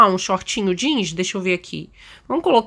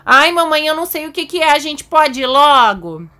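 put-on higher, whiny kid voice; lightly voiced impatient child; impatient little daughter; 6 semitones higher, 4 dB louder than usual. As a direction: play a child itching to go, pitched up. Impatient daughter urging mother to go.

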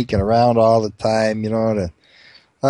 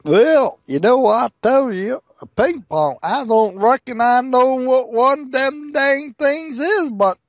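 high, drawn-out hillbilly voice; backwards mountain brother; 12 semitones higher than usual. Acted. First mountain brother answering the farmer, hillbilly voice. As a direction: play a slow-talking backwoods rube. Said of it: "they're really backwards dudes".

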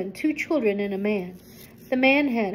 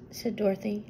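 plain reading voice

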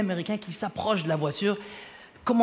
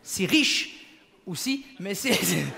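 normal talking voice

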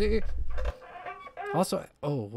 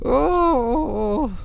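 crying out; 6 semitones higher, 6 dB louder than usual. Real, not acted. Cry after near death.

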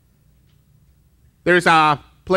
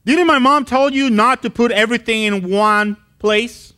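put-on scolding older brother; 6 semitones higher than usual. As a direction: nag him like a bossy big brother. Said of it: "and he says".

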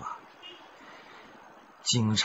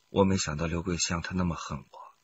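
ordinary talk, nothing marked

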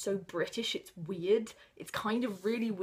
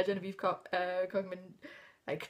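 usual speaking voice

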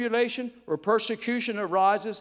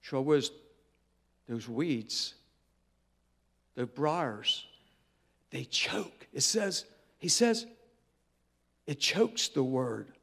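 hushed; 6 semitones lower, 8 dB quieter, slower than usual. Acted. Preacher hushes on choking weeds.

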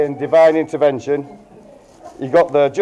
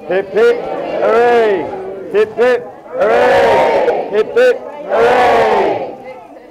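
drawn-out rallying cheer, higher and slow; toast cheer; 7 semitones higher, much slower than usual. Real, not acted. Best man leading the guests in a hip hip hooray. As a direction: lead the room in three cheers.

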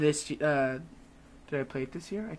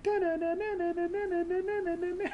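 high sung news theme jingle; news ticker jingle; 12 semitones higher, 7 dB quieter than usual. Retold or imitated. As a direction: hum a news broadcast intro tune. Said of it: "like a little newscaster thing".

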